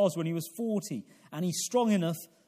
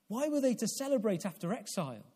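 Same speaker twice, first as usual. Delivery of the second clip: ordinary talk, nothing marked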